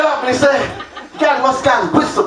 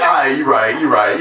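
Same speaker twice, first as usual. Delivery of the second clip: much lower, easy-going "you right"; low resigned concession; 10 semitones lower than usual. Retold or imitated. Dad admitting he's wrong, low mock concession. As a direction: drop deep and shrug it off. Comedian conceding when caught.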